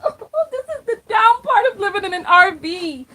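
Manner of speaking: high, breaking laugh-cry through the words; giggling mock sob about the mess in the RV; laughing half-crying. Real, not acted. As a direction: laugh helplessly while complaining. Laughing at her own chaos.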